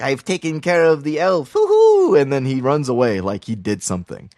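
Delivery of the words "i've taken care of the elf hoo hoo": squeaky high, louder imp's triumphant hoot; gloating demon. Retold or imitated. Little demon gloating after cutting the elf, shrill hoot. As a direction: crow over it like a petty imp. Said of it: "he's like".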